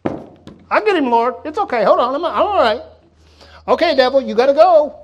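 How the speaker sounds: high, loud, fumbling angel Clarence; eager bumbling angel. Pretend. Bungling angel Clarence reassuring the Lord, high and loud. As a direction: play a clumsy angel who insists he's fine. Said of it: "the angel that's probably gonna be like".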